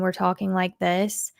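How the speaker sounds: lightly flattened, monotone delivery. Shown as a demonstration. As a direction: talk in a mild monotone. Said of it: "If we are very monotone".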